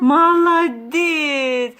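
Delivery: long, stretched-out cheer; excited praise. Real, not acted. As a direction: drag the word out proudly. Praising toddler's right answer.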